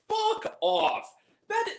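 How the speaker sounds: high, scornful outburst; exasperated retort. Real, not acted. Reviewer dismissing the film's preachy speech, exasperated high outburst. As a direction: jump up high in sudden disgust.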